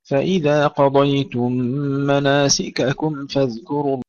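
slow, measured tajweed recitation with long held vowels; Quran recitation. Acted. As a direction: recite slowly, drawing out the elongations and nasal sounds. Recites verses before teaching them. (تلاوة مرتلة بطيئة بأحكام التجويد)

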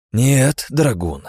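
angry loud hiss. Acted. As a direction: hiss angrily at husband. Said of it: "прошипела гневно демонесса".

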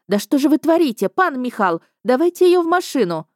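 urgent scolding medic. A narrator's reading.

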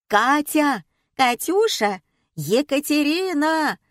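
group hollering for someone, each call varied; hollered calling. A narrator's reading. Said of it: "вопили члены группы на разные лады".

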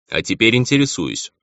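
mildly snappish reply; sharp retort. A narrator's reading. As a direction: answer back sharply. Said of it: "сказал он резко".

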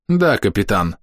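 mildly weary reply; understated, tired acknowledgement. A narrator's reading. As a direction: answer with a touch of fatigue. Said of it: "устало ответила она".

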